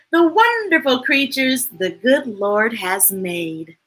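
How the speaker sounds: lively sing-song picture-book reading; reading to a child. A narrator's reading. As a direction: read it bright and bouncy for a little one. Reading a rhyming picture book aloud.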